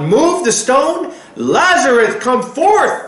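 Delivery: high, slow, loud command; commanding order. Acted. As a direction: call it out with full authority. Yeshua calls Lazarus out.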